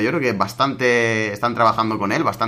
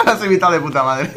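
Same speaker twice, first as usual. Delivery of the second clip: laughing while talking; 5 semitones higher, 3 dB louder than usual. Real, not acted. Laughing at the impression.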